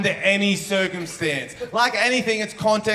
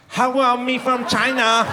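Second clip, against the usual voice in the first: put-on higher, slow caricatured Chinese accent; mock Chinese accent; 4 semitones higher than usual. Comic imagines addressing man on the train, mock Chinese accent.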